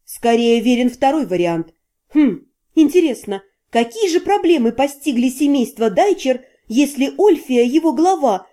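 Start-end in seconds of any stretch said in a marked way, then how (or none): none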